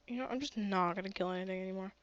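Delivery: ordinary talk, nothing marked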